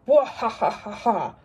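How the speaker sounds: hammed-up evil cackle; a storybook alligator's big evil laugh; villain laugh. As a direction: cackle like a pantomime baddie. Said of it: "laughed Alan".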